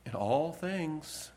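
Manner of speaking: lightly imitated radio host, words drawn out; imitated emphatic retort. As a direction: stretch it out slowly as an obvious answer. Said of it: "He says".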